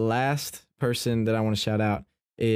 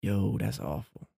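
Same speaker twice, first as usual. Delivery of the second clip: sympathetic yo; 4 semitones lower, 6 dB quieter than usual. Shown as a demonstration. Consoling a friend after hearing bad news, soft and low. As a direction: lower and softer, a sorry "Yo, that's awful". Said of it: "like that, you can say, like, different".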